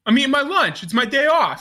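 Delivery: high, exasperated retold complaint; mock mad. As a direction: imitate an annoyed man on his day off. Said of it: "He's so mad".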